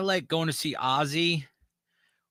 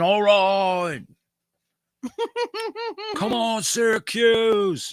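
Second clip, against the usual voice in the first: rock frontman; 8 semitones higher, 4 dB louder, slower than usual. Retold or imitated. [name] addressing concert crowd.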